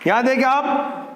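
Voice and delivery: ordinary talk, nothing marked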